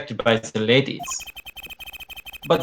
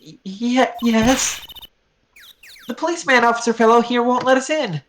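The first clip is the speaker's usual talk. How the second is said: put-on higher, stammering girl voice; flustered girl; 11 semitones higher than usual. Girl stammers to the charming stranger, higher and nervous. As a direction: play her nervous and high.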